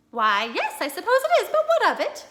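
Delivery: rising up in bafflement; puzzled wife. Acted. Wife puzzled by husband's sudden interest, high and loud.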